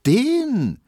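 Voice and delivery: high, loud Berlin disdain; scornful, high-pitched outburst; contemptuous. A narrator's reading. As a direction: dismiss them all with loud scorn. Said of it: "meinte Hedwig verächtlich".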